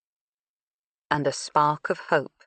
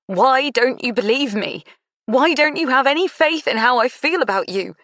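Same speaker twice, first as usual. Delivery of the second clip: higher, louder pleading shout; yelling; 8 semitones higher, 5 dB louder than usual. Acted. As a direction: shout it in frustration. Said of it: "She wanted to shake him and yell".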